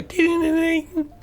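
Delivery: high sing-song ding ding like a little bell; toe bell ringing. Retold or imitated. Dad rings the toe bell to spook them, high chiming. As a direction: chime it like the corpse bell.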